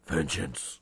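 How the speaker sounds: low spoof-hero growl; Batman. Retold or imitated. Batman answering what he wants for dinner, deep growl. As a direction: growl it deep, one dramatic word. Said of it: "The best Batman spoof ever".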